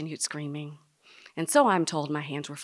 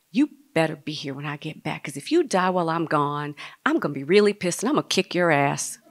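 loud, firm loving warning; mock threat; 7 dB louder, faster than usual. Daughter warning her dying dad before a bathroom break, mock threat. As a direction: lay down the law, loudly.

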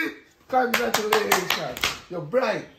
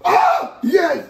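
high, loud whoop of delight; excited outburst; 7 semitones higher, 9 dB louder than usual. Acted. Grandchild let off to learn letters, excited high yell. Exclaims high at being dismissed.

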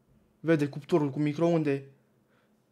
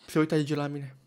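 ordinary delivery

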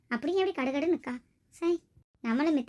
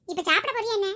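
put-on louder, higher snap at the child; angry father; 6 semitones higher, 4 dB louder than usual. Father fed up with his child refusing food, angry shout. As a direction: lose your temper at the fussy kid.

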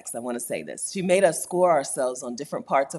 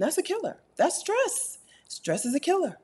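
high warning; 12 semitones higher than usual. Real, not acted. Stressing danger, voice pitched up.

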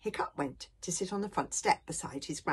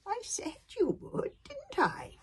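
put-on high-pitched self-satisfied old grandfather; high, smug I-told-you-so; pleased with himself; slower than usual. A narrator's reading. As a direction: gloat a little as the old man. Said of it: "very pleased with himself".

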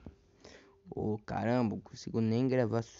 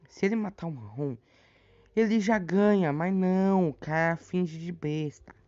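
exasperated outburst; 8 semitones higher, 6 dB louder than usual. Real, not acted. Frustrated at game outcome.

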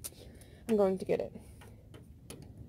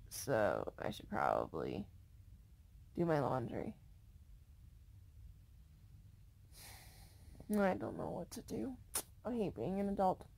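sleepy; 8 dB quieter than usual. Real, not acted. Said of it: "I'm really, really sleepy".

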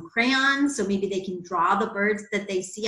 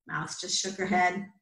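usual speaking voice

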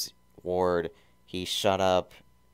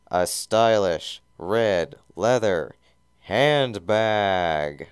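mock-grand emphasis; 7 dB louder, much slower than usual. A narrator's reading. Narrator savours the handbag.

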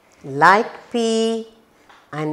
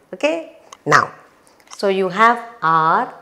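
ordinary talk

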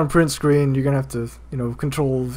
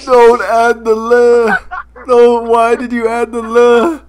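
mock anguish; 3 semitones higher, 4 dB louder than usual. Protesting the "le" added to the name, mock anguish. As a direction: plead in hammed-up despair.

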